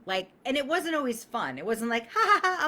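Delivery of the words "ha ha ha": high, loud fake cheerful laugh; sarcastic hammed-up cackle; mock laugh. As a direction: ham up a carefree laugh. Mocking fun awkwardness.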